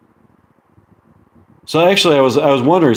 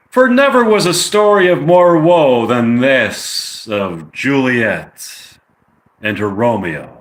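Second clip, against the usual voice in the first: dramatic Shakespeare recital; 3 semitones higher than usual. Acted. Streamer reciting the end of Romeo and Juliet to listeners, dramatic declamation. Recites the closing lines of a play.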